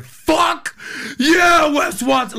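loud fired-up gym bro yell; hyped cheer. Retold or imitated. Guys hyped by the message, shouting a loud cheer. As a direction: roar it like pumped-up guys in a gym. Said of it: "They're like".

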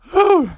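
high, excited whoop of breath; excited whew. Real, not acted. Host overwhelmed by the project's success, excited whew. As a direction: let out a high, giddy whew. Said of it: "can you hear the excitement in my voice".

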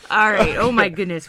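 ordinary talk, nothing marked

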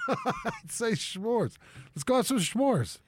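"s'mores" said as shmores, laughing; sh pronunciation. Shown as a demonstration. Host defends his habit, "s'mores" said as shmores. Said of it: "There's no H in there".

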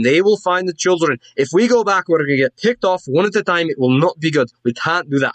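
insistent dwarf taking charge; dwarven woman. Acted. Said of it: "you hear the dwarven woman say".

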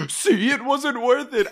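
high, loud put-on voice; mock ghost dismissing the friendship, high and loud; ghost verdict.

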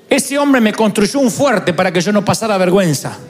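ordinary talk

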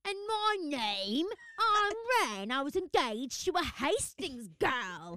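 hammed-up slow British accent, high and loud; mock British accent, slow and pompous. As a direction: lay on a posh British accent as Ren. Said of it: "my British accent".

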